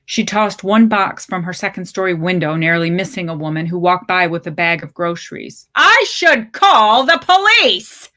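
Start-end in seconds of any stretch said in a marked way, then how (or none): 5.8-8.1 high, loud, slow furious yell; angry yell. Acted. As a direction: shout up at the window in shock. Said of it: "yelled the woman".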